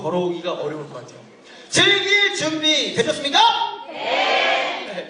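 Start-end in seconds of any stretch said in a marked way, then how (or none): 1.7-3.4 higher, shouted hype-man question; rousing crowd call. Real, not acted. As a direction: fire up a sluggish audience. Repeats call after weak audience response. (관객의 호응을 끌어내는 힘찬 외침)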